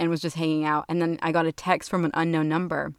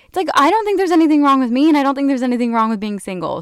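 heated defence; 8 semitones higher, 7 dB louder, faster than usual. Real, not acted. Defending being single.

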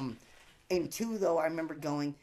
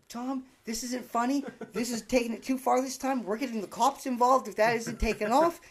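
stern parent message; 6 semitones higher, 6 dB louder than usual. Retold or imitated. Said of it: "calls on an answering machine".

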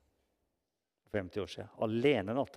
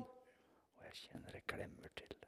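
very soft, breath-only storytelling; hushed awe at the bedside; 25 dB quieter than usual. Real, not acted. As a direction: drop to a near whisper. Hushed climax of deathbed story.

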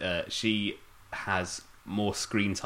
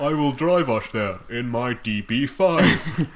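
louder, slowed put-on Bond voice; mock Bond; 5 semitones higher, 7 dB louder, slower than usual. Host riffing as Bond, hammed-up voice. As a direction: swagger it as pompous Bond. Joining the camp Bond riff.

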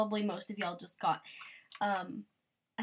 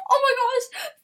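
gasp, then a high, loud "oh my gosh"; shocked by the note, shrieking it; surprised gasp; 12 dB louder, faster than usual. Real, not acted. Reacts to a note in game.

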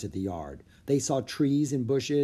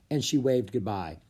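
plain reading voice

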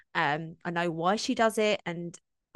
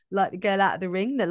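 ordinary delivery

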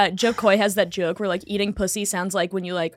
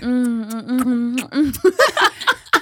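mock slurping moans; 2 semitones higher, much slower than usual. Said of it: "he's like".

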